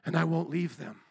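tender Jesus. Pretend. Jesus promising to come for mankind, soft tender voice. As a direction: hush it down like a loving promise. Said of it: "But Jesus says".

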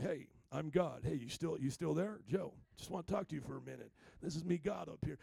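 low, hammed-up voice of God; God's voice. God interrupting Joe's thoughts for a chat, deep mock-divine voice. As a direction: play a deep-voiced God dropping in casually. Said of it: "We're not gonna hear God".

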